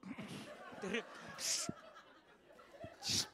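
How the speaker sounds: unvoiced slow whisper; whispering over a crib. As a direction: whisper so he won't wake. Whispering beside sleeper.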